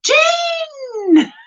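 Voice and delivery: high, drawn-out shouted name; Raven, excited call. Retold or imitated. Playing a chat member answering a friend's hello, excited high call. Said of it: "here goes Raven".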